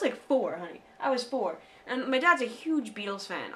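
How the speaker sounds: ordinary delivery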